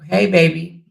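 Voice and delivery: normal talking voice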